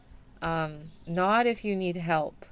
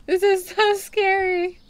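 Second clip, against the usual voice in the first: mock scared; 10 semitones higher, 6 dB louder than usual. Real, not acted. Creepy store in the dark.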